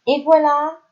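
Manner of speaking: a proud close to the year's lessons; upbeat teacher finale; cheerful wrap-up. Real, not acted. Triumphant end of lesson.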